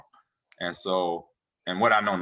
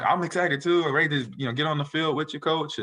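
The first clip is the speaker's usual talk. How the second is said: excited too; 8 semitones higher than usual. Retold or imitated. Retold reply to eager coach.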